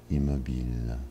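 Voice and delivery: ordinary talk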